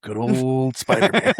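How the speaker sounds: slow sarcastic drawl. Retold or imitated. Imitating the Vulture's sarcasm.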